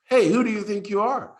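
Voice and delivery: high, loud teasing challenge; making fun. Retold or imitated. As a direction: imitate a friend hooting with mockery. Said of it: "bursts into laughter and starts making fun of me".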